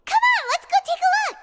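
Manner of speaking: eager. Acted. Companion urging the group toward the fountain, eager and fast. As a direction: hurry everyone along like an eager kid.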